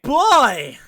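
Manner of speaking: excited shout. Real, not acted. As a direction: shout it with mock dread. Oh boy exclamation.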